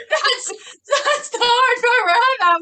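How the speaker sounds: laughing. Real, not acted. Laughing about her classroom dilemma.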